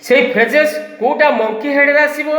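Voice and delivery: ordinary talk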